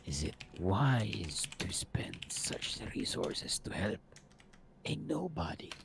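hushed stage whisper with some voice breaking through; attempted whisper. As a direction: try to whisper a doubtful question to your queen. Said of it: "this guy tries to whisper".

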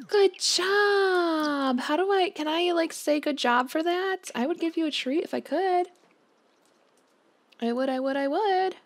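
high cooing pet voice. Real, not acted. Praising pet in baby voice.

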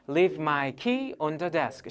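very slow and a bit soft; slow model. Shown as a demonstration. Teacher slowly modelling the sentence for students. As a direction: draw each word out gently. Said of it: "시작".

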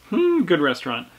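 ordinary talk, nothing marked

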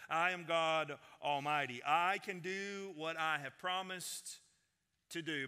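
normal talking voice

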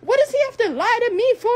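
high-pitched mocking imitation of her; mimicking her. Retelling her excuse on live, mocking high voice. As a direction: put on her voice, pitched well up. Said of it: "She's like".

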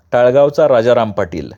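usual speaking voice

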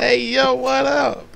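high, loud hype greeting; hype man voice. Acted.

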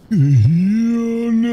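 moaning zombie voice. Pretend. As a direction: groan it like a hungry zombie. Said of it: "Die läuft wie ein Zombie".

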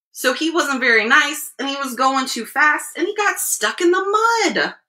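ordinary delivery, nothing marked

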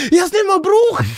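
high-pitched mock wail of regret; exasperated protest. Friend confessing a pointless theft, exasperated.

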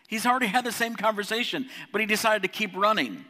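fervent preaching. Real, not acted. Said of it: "preacher explaining, rising".

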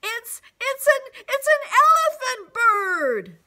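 amazed shouting. A narrator's reading. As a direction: burst out with the astonishing discovery. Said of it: "they shouted".

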